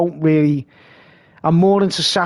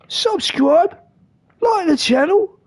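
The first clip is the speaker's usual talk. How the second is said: higher mock YouTuber sign-off; imitating the friend; 12 semitones higher, slower than usual. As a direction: ask for likes in his friend's pitched-up voice. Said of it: "He talks like this".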